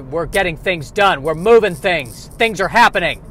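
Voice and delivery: building from steady to loud and pumped; busy office energy. Acted. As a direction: rev up like a go-getter at work. Office go-getter building excitement.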